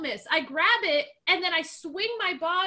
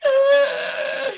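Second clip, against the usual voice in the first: strained groan; 9 semitones higher, 9 dB louder than usual. Retold or imitated. Struggling for air.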